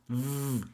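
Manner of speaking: "V" as a long buzzing vvv; exaggerated V. Shown as a demonstration. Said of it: "don't do that when you're talking".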